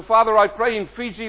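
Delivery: normal talking voice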